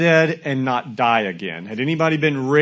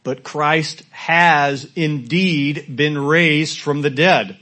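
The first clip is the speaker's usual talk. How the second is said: slow, loud proclaimed verse; scripture reading; 3 semitones higher, 5 dB louder, much slower than usual. Shown as a demonstration. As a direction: stretch "has" out and proclaim it. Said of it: "verse twenty".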